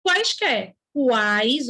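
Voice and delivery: "Quaisquer" with a clear w, then "uais" stretched; "uais" drawn out to expose the u; u pronounced. Shown as a demonstration. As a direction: isolate the glide as "uais" after "Quaisquer". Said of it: "O U é pronunciado".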